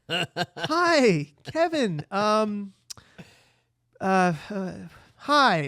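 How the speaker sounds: high, loud, stammering hi; flustered awkward greeting. Retold or imitated. Margaret stalling on the phone with Kevin, high flustered stammer. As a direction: fumble for words, caught off guard.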